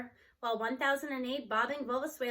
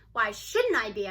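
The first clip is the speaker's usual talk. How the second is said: a touch of irritation, a little louder; irritable; 4 semitones lower, 4 dB louder than usual. Acted. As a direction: snap the question back, mildly annoyed. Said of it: "I ask irritably".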